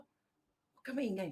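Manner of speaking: soft, slow hush to stay quiet; slow hushed order to keep quiet; hushed warning. Retold or imitated. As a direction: keep it barely audible, drawn out. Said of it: "sabi ko".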